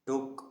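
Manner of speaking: took with a short u sound; short pesh vowel in took. Shown as a demonstration. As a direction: say took with the double O kept short. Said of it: "وہ پیش کی آواز دے رہا ہے".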